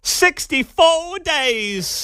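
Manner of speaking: high, very drawn-out mock rally cry; Kamala Harris. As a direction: stretch it way out, parodying a politician. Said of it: "My name is Kamala Harris".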